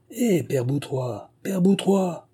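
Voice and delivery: insistent call at moderate volume; calling out repeatedly. A narrator's reading. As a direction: hail someone from outside the door. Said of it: "appelait-on coup sur coup".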